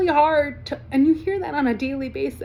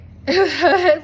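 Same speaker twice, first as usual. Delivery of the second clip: incredulous laugh; 3 semitones higher, 6 dB louder than usual. Real, not acted. Laughs after the car story.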